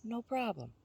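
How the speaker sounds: lightly voiced tiny Hoopoe; tiny bird voice. A narrator's reading. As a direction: shrink the voice to a pocket-sized bird. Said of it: "in a miniaturized voice".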